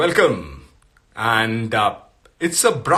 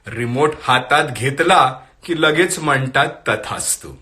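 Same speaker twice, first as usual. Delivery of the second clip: louder, lilting Marathi verse reading with lifted pitch; poetry recitation; 2 semitones higher, 4 dB louder, much faster than usual. Acted. Host reads a forwarded Marathi poem to viewers, poetry recitation. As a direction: recite the poem line by line to an audience.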